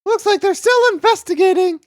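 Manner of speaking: put-on very high, squeaky young-character voice. Streamer voices Emma's game lines, high squeaky character voice. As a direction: pitch it way up into a light squeak. Voicing game dialogue for Emma.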